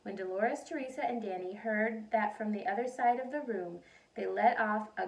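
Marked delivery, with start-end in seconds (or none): none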